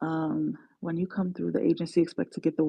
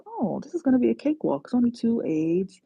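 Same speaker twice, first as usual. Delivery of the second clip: naive confidence; 6 semitones higher than usual. Retold or imitated. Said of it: "I'm like".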